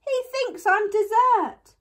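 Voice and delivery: put-on high, loud panicked child; frightened cry. A narrator's reading. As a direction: cry it out in alarm. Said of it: "cried Eddie".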